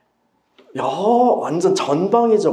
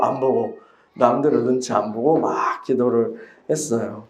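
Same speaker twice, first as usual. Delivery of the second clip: ordinary talk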